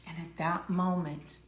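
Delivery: ordinary talk, nothing marked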